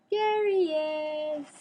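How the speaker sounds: high, slow delighted coo; cooing reveal. Real, not acted. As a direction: show off the cat. Showing the cat on camera.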